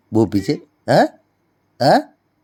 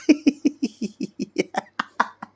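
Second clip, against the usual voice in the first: long high-pitched giggle; real peal of high giggles; giggling; 13 semitones higher, 4 dB quieter than usual. Real, not acted. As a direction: burst into high, helpless giggling. Laughs while being teased.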